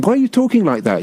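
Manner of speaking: high-pitched mock protest; mock exasperation. Fan challenging an actor over his fake accent, mock exasperated. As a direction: challenge him in playful disbelief.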